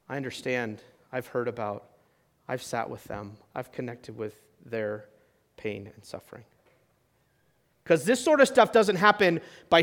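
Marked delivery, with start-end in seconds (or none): 0.1-6.4 low, hushed, tender voice; quiet empathy. Acted. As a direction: speak softly, as if sitting with someone hurting. Voicing empathy toward a sufferer.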